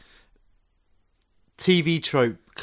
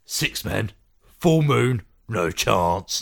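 Sean Pertwee accent; slower than usual. Shown as a demonstration. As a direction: do it as a gruff British Sean Pertwee. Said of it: "Do it in a Sean Pertwee accent".

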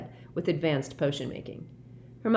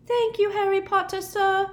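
high, bright-hearted elf squeak; happy squeak; 5 dB louder than usual. A narrator's reading. Dobby happily thanks Harry in a high squeak. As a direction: squeak your thanks with glee. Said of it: "squeaked Dobby happily".

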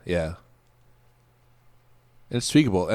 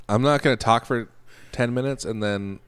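ordinary talk, nothing marked

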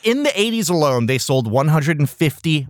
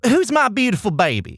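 pageant mom voice; 5 semitones higher than usual. Retold or imitated. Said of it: "sounds like a mom from Texas who puts her daughter in pageants".

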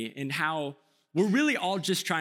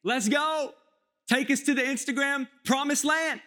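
high, eager hype; imitating pumped friends, much higher; 8 semitones higher than usual. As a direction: cheer like a buddy ready for the hike. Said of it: "we're just pumped".

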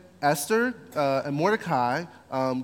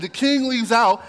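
usual speaking voice

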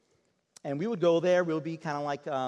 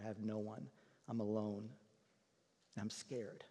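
quiet sad confession; 4 semitones lower, 10 dB quieter than usual. Retold or imitated. Mother confides her fear.

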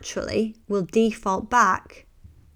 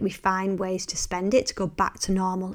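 usual speaking voice

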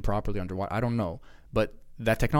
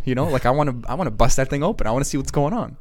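normal talking voice

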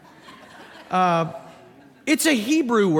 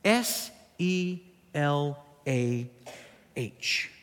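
slow, separate letters; spelling out; 4 semitones lower, 6 dB quieter, much slower than usual. Shown as a demonstration. Pastor spells the Bible word for the church, slowly spelled out.

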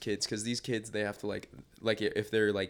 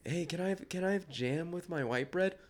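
put-on whiny kid voice; 8 semitones higher, 4 dB quieter than usual. Voicing kid asking for jam.

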